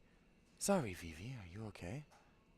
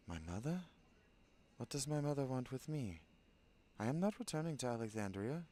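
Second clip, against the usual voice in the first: ordinary talk, nothing marked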